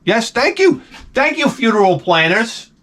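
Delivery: high-pitched mock gratitude; sarcastic thanks. Comic to funeral planners who booked his birthday, sarcastic.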